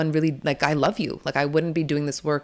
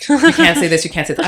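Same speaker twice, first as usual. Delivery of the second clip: mock rules voice; 10 dB louder than usual. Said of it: "they even have disclaimers".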